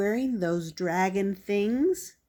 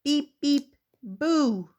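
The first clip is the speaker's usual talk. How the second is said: higher, punchy beeps and a playful boo; Little Blue truck horn; 5 semitones higher than usual. Acted. Little Blue revealed as the ghost, playful honking. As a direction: beep like a toy truck, then spring the boo. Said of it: "Little Blue Truck says".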